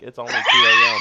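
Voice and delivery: high yelled protest; outraged shout. Retold or imitated. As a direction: yell it in disbelief. Quoting himself protesting.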